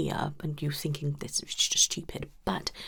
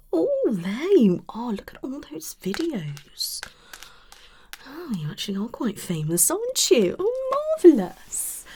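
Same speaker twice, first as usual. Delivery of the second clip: higher and louder, suddenly thrilled; gushing excitement; 8 semitones higher, 8 dB louder than usual. Acted. Therapist gushing over a famous client, excited. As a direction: gasp over his videos like a starstruck fan.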